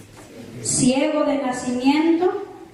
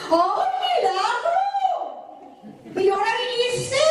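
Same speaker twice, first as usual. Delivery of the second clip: exaggerated high-pitched jibe; sarcastic mock surprise; 8 semitones higher than usual. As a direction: act out a snide welcome. Mocking greeting to a returning member.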